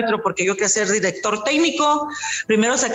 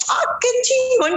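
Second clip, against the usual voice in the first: thrilled disbelief; 11 semitones higher, slower than usual. Retold or imitated. Realising she is the referee.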